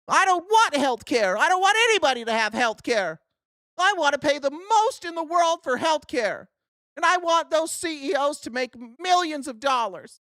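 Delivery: high, hammed-up protest; mock indignant partisan. Mocks opponents of health care.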